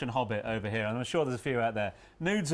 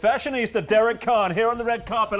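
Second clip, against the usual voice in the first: award show announcer; 7 semitones higher, 9 dB louder than usual. Pretend. Announces award like ceremony host.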